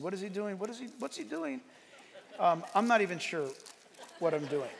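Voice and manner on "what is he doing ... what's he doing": hushed, puzzled murmur rising in pitch; puzzled media crew. Retold or imitated. Preacher imitating the poor media people, puzzled hush. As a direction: quietly mimic baffled crew asking each other. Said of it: "the poor media people are saying".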